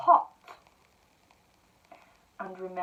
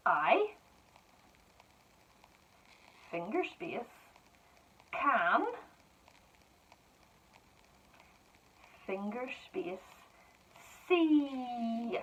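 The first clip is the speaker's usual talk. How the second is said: slow dictation; 4 semitones higher, much slower than usual. Shown as a demonstration. Teacher dictating to pupils, words spaced apart. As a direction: spell it out for children, word by word.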